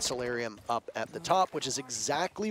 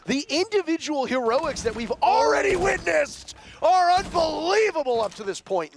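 loud, much higher hype call; excited shout; 11 semitones higher, 5 dB louder than usual. Real, not acted. Caster roaring at the heroics to co-caster Nick. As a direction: belt out the heroics.

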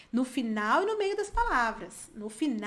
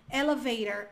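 "elevator" with tapped t and r-coloured ending; American pronunciation of "elevator"; American accent. Shown as a demonstration. Teacher's example of the middle T, American accent. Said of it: "é o americano".

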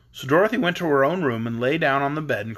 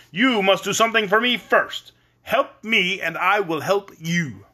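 higher, emphatic wizard pronouncement; commanding great head; 6 semitones higher, 4 dB louder than usual. A narrator's reading. Great head bargaining with Dorothy, commanding. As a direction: lay down the terms forcefully. Oz head's voice in story.

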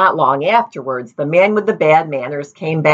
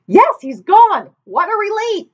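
high relieved villager. A narrator's reading. Another villager voiced.

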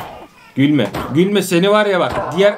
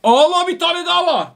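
high-pitched startled exclamation; alarmed cry; 11 semitones higher than usual. Real, not acted. Gamer spots yet another enemy, alarmed cry.

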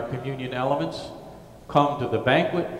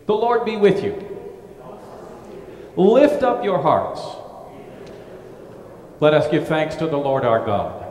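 ringing, lifted liturgical call; cathedral echo; 4 semitones higher, 3 dB louder than usual. Acted. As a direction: call it out to the whole church. Presider intones liturgy responses.

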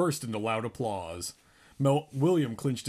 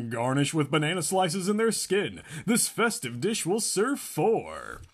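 TV cooking host; 6 semitones higher, 4 dB louder than usual. Acted. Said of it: "It was a cooking program".

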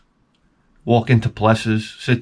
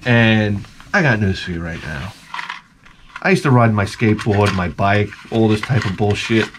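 normal talking voice

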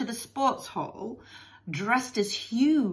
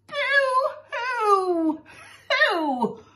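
high, loud storybook boo-hoo; mock bawling; 4 dB louder, much slower than usual. Missus Lovey-Dovey bursts into tears, hammed-up wailing. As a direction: wail out a hammed-up sob. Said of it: "turn to burst into tears".

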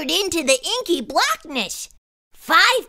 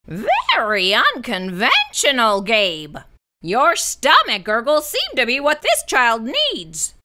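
monster teacher voice; 4 semitones lower than usual. A narrator's reading. Mister Z voiced.

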